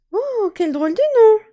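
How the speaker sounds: very high, whispered then voiced fairy voice; a little fairy greeting a boy; tiny fairy. Fairy greets Jacques at the hearth, tiny high voice. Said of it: "la fée lui répond".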